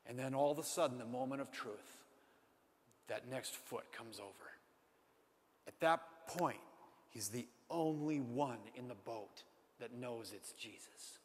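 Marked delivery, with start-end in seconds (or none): 1.0-4.5 soft, near-whispered climax; lowered storyteller voice at the climax; hushed suspense. A narrator's reading. 7.1-11.2 quiet emphasis. A narrator's reading.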